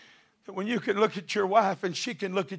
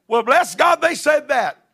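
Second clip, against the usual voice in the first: indignant mock quote; 9 semitones higher, 11 dB louder than usual. Mocking a grudge-holder's indignant reply.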